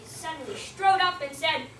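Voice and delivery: ordinary delivery, nothing marked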